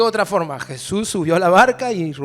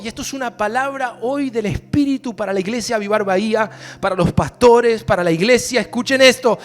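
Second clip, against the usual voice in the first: storm preaching; 5 semitones higher, 6 dB louder than usual. Acted. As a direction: drive the storm home, stretching the words. Preacher applies it to church.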